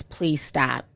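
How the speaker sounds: quiet plea. Retold or imitated. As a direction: let the plea die away almost to nothing. Desperate message, last plea.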